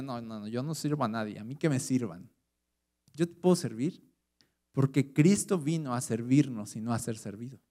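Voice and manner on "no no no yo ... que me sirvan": mildly hammed-up proud voice, softer and fast; mock arrogant refusal. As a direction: imitate someone too proud to serve, quietly and quickly. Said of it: "No soy orgulloso así de".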